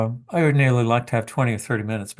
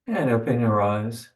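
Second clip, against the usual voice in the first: guided meditation. Acted. Leads a meditation.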